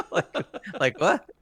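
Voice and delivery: high, laughing mock bafflement; retold baffled reaction. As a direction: imitate a taken-aback reaction through laughter. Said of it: "he sits there going like".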